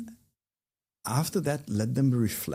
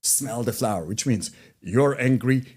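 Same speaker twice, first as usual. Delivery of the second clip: judgmental scolding; 3 semitones higher, 5 dB louder than usual. Shown as a demonstration. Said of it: "It's judgmental".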